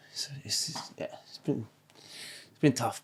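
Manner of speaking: real tears, words nearly breaking into a whisper; fighting back tears, barely voiced; fading, tearful half-whisper; choked up. Real, not acted. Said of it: "I'm tearful and I'm emotional right now".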